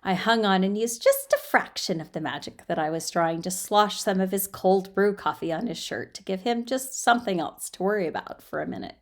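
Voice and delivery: plain reading voice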